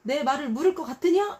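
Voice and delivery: high, loud scolding; angry rebuke. Retold or imitated. God voiced rebuking people, high and loud. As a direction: thunder the questions down. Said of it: "막 이러죠".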